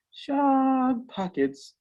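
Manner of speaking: very slow, high, drawn-out Shog pockets; deadpan line. Retold or imitated. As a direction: stretch out Shog pockets like a creepy tease. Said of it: "Tom will lean over and say".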